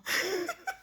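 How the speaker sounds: short high delighted giggle; a bright squeal of a laugh. Real, not acted. Reacting to nephew waving.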